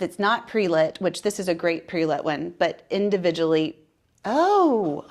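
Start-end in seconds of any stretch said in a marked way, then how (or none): 4.2-5.1 sudden surprised gasp; startled oh. Real, not acted. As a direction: caught off guard. Lights suddenly go out.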